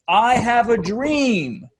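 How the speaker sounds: a touch of Martin Luther King; oratorical callback. Retold or imitated. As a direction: proclaim it like a preacher. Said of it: "is a rhythm".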